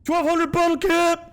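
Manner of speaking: high, loud delighted squeal; excited squeal. Real, not acted. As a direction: squeal with glee at the money. Streamer reacts to big payout.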